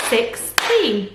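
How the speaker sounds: put-on "Sixteen" in two beats, six, teen; split into syllables. Shown as a demonstration. Teacher confirming two syllables, "Sixteen" split in two. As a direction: separate six from teen with a short gap. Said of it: "Two syllables".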